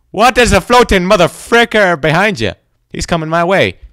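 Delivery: high, loud startled yell; alarmed shouting. Real, not acted. Player spotting a floating thing coming his way, alarmed shouting. Monster appears behind.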